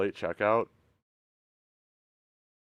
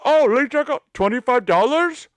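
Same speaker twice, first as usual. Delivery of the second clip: high, loud retold anger; hammed-up furious yelling; mock outraged; 12 semitones higher, 9 dB louder than usual. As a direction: yell it back at the clerk, indignant. Retelling angry hotel confrontation.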